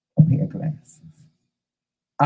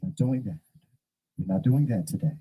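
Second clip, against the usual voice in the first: calm polite refusal; 6 semitones lower, 7 dB quieter, faster than usual. Retold or imitated. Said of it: "I just simply said".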